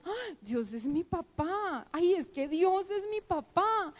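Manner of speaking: acted-out wide-eyed realisation; retold joyful revelation. Acts out repeated discovery while reading.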